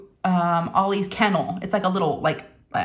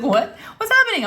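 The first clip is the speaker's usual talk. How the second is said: higher-pitched retold startled cry; startled waking; 11 semitones higher than usual. Daughter woken in the night, startled. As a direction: imitate someone waking up frantic. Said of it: "she wakes up all crazy".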